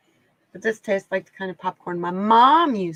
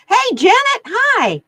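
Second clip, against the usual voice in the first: excited greeting; 13 semitones higher, 11 dB louder, slower than usual. Real, not acted. Greeting a viewer in chat.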